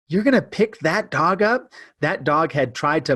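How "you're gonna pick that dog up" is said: high, incredulous mock question; friend's shock. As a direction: voice the friend's disbelief. Friend shocked at picking up dog.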